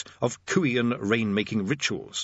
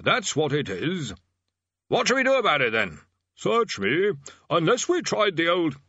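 senior tutor voice; 7 semitones higher than usual. A narrator's reading.

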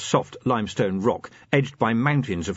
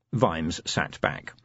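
plain reading voice

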